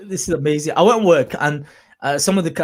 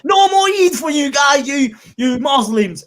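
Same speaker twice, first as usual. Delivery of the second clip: slow, higher mock proclamation, shouted; mock prime minister shout; 8 semitones higher, 3 dB louder, slower than usual. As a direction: bellow it like a pompous leader, slowly. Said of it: "Boris Johnson turns up and he says".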